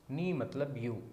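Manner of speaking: ordinary talk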